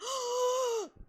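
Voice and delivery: long, high, delighted mock gasp; imitated excited gasp. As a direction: gasp as an amazed fan would. Acting out her delighted reaction.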